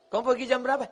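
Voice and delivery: high, softly lilting question; coquettish, sighing voice. Shown as a demonstration. Preacher shows women how not to speak, sighing flirty question. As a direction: ask it in an exaggerated sultry lilt. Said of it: "mendesah-desah".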